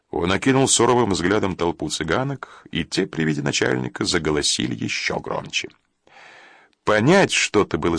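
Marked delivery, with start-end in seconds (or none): none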